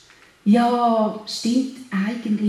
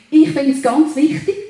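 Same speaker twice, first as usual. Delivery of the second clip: ordinary talk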